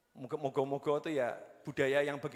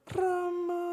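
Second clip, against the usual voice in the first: high, drawn-out demure call; stylised high wayang-orang daughter voice; daughter addressing parent; 13 semitones higher than usual. Shown as a demonstration. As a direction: act a dutiful daughter, sustaining a high note. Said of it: "tak praktekno".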